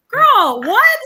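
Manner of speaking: high, loud incredulous outburst; mock disbelief. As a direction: blurt it out, aghast and laughing. Acted shocked reaction to Sandy's line.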